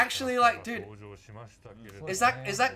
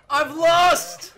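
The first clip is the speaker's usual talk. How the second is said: loud, drawn-out cry; real excited outburst; overwhelmed yell; 3 semitones higher, 5 dB louder than usual. Real, not acted. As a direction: holler it in giddy surrender. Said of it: "I'm yelling so much".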